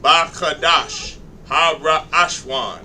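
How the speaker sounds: slow, sounding out ba, kadash, ha, ra one syllable at a time. Shown as a demonstration. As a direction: sound out each syllable slowly, like following with a finger. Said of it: "Just like that".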